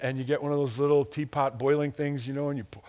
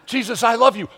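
an unashamed cry of love, as if alone in the car; loud declaration; 8 semitones higher, 8 dB louder than usual. Shown as a demonstration. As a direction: belt it out, high and loud. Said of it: "You can be weird all by yourself in the car".

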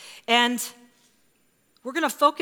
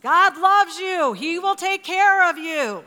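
slow, raised, higher call upstairs; dad calling back reassurance; 8 semitones higher, 6 dB louder, slower than usual. Retold or imitated. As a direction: imitate a father patiently yelling comfort to his child. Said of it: "Tony gives her the same response".

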